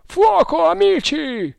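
very high, loud battle yell; shouted rallying cry. A narrator's reading. Second man on the terrace to his friends, high rallying shout. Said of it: "gridò un'altra voce".

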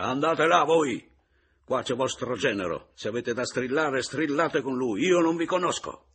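loud, high, furious dismissal; angry shout. Acted. Man pushes away attacking mother-in-law, furious shouting. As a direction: drive her off with a full-voiced yell.

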